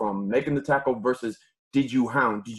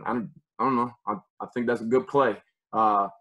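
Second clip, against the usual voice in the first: ordinary delivery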